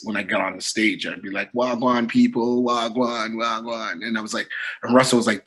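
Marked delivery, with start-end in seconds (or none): 1.5-4.0 slow Jamaican patois greeting; drawn-out Jamaican street greeting, tailing off softer; Jamaican street talk. Acted. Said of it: "talk like you talk in the streets".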